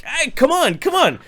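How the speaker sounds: high-pitched, pushy coaxing. Retold or imitated. Drunk host coaxing a stranger onto the porch, high and urging.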